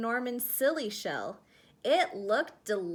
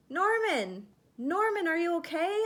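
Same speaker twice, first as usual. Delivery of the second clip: higher anxious calling out; worried calling; 6 semitones higher, 3 dB louder than usual. Acted. Snails calling to fallen Norman, worried. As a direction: call out to a fallen friend. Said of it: "asked the snails".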